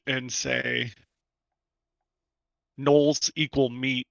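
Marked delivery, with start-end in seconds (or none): 2.8-4.1 slow, simple words; slow deliberate. Acted. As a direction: say it slowly like a caveman. Hamrick replies via message.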